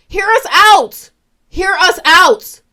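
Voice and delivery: hear-me-out emphasis. Real, not acted. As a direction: press it, slow and loud. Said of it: "I'm not trying to scream at y'all".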